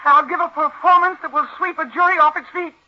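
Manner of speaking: high, loud and wild defiance; defiant outburst. Acted. Exposed actress rails at the doctor in loud defiance. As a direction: rant that no court will convict her.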